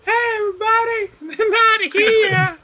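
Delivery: very high, strained put-on orc call; elf's fake orcish voice. Elf trying to fool the orcs, hammed-up orcish voice. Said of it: "put on their best orcish voice".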